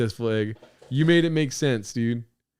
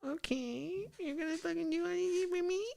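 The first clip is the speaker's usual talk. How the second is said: tiny, high, hushed mock-girl voice; put-on soft, high teasing voice; imagined coy girlfriend; 12 semitones higher, 9 dB quieter than usual. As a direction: imitate her cooing, very softly and high. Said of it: "she's gonna sit there like that".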